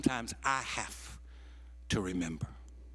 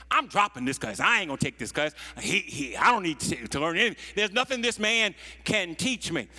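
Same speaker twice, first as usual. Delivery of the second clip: loud, indignant protest; mock students storming off; ranting students; 5 semitones higher, 6 dB louder than usual. Students ranting about dropping class.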